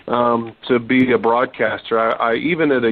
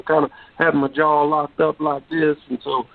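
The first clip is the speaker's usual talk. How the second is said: higher-pitched Texas drawl with a locked jaw; 3 semitones higher than usual. Shown as a demonstration. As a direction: imitate your old Texas drawl, jaw locked tight. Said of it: "really high voice with a Texas drawl".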